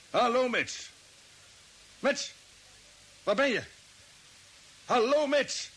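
calling out, growing worried; urgent radio calls. Acted.

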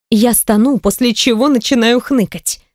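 usual speaking voice

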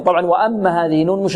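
ordinary talk, nothing marked

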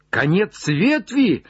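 high, drawn-out cry; exclaiming cry. Acted. Legrand hears Jupiter reached the end, high cry. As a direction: cry out at the news. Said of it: "вскричал Легран".

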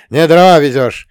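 loud, angry bark at the driver; bellowed reprimand; barked curse. A narrator's reading. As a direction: roar at a subordinate. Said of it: "рявкнул дядя Илья".

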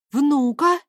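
astonished cry. A narrator's reading. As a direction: cry out in disbelief. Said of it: "крикнула Снежка".

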